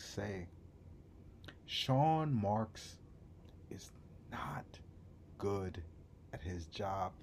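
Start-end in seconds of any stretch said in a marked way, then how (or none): 1.7-7.1 drops to a hushed whisper. Real, not acted. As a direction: lean in and whisper it. Hushed verdict.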